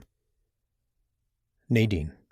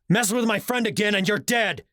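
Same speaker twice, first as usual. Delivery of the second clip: yelling; 12 semitones higher than usual. A narrator's reading. Brawl, continuing screamed threat.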